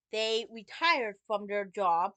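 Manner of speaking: ordinary talk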